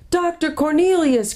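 high-pitched, delighted exclamation; joyful cry. A narrator's reading. Caspian greeting his old tutor, overjoyed. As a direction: cry the name out with joy. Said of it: "cried Caspian with joy".

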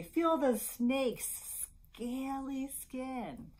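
high, slow, drawn-out storytelling; playful read-aloud. Acted. Librarian showing parents how to read aloud, slow and playful. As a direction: stretch the words out for a baby.

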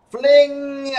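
high, loud flung-out word; excited. A narrator's reading. Narrator telling children how Bear was flung, excited. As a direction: launch the word like a catapult.

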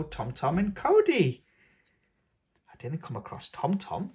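plain reading voice

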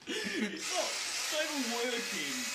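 high, indignant it's not even working; can't believe the whisk won't work; disbelief. Real, not acted. Friend complains in disbelief that the whisk is dead.

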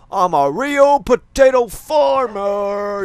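drawn-out triumphant yell; slowly and loudly crow it out; proud shout. Real, not acted. Gamer bragging to viewers about potatoes, slow triumphant shout. Thrilled by his huge potato stack.

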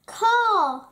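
loud, high caw; croaking crow. A narrator's reading. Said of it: "he croaked".

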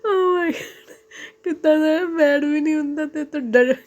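high squeal through helpless laughter; laughing uncontrollably. Real, not acted. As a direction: talk while cracking up. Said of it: "ਮੈਂ ਆਪਦਾ ਹਾਸਾ ਨਹੀਂ control ਕਰ ਸਕੀ".

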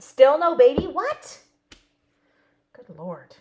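loud, high surprised outburst. Real, not acted. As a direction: blurt it out in real disbelief. Shocked at news about Sharon.

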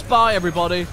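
loud farewell. Acted. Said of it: "Please say the line".

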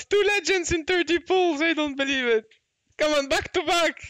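high, amazed outburst; exclaim, astonished at the luck; excited disbelief. Real, not acted. Amazed at two legends in pulls.

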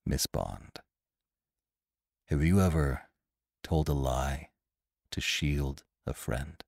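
low, hushed, weighted question; quiet voice that forces attention; low, intense feeling. A narrator's reading. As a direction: ask softly and gravely, pausing between phrases. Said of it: "low and vibrating with feeling".

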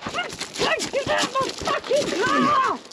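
high, drawn-out furious scream; screamed order. Real, not acted. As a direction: stretch every word into a scream. Ordering intruder out of car.

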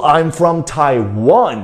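slow, stress on the wan of Taiwan; I'm from Taiwan, slow, last syllable strong. Shown as a demonstration. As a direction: spell it out clearly for the learners. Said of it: "一定要说".

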